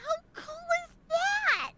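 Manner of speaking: high-pitched delighted gush; thrilled squeal. Real, not acted. Friend hears of meeting a film director, thrilled squeal.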